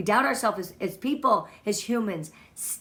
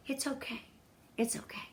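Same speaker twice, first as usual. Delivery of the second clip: soft, gentle it's okay, fading to near whisper; tender hushed reassurance; soothing reassurance; 3 semitones higher, 10 dB quieter than usual. Real, not acted. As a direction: comfort them quietly. Reassuring audience softly.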